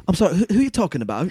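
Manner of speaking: mock British dude; British accent. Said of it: "this British dude was like".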